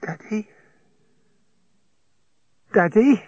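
high rising calls for Daddy; calling out loud. A narrator's reading. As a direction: call out high, delirious. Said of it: "She said in a loud voice".

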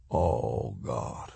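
low, hushed murmur in the dark; whispered. A narrator's reading. Man alone in the dark, whispering. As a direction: let it sink away, very soft. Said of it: "he whispered in the dark".

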